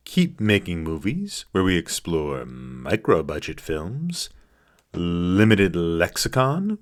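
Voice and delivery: announcer reading the channel plugs; podcast outro read. Acted. Host reads the outro ad to listeners, smooth announcer delivery.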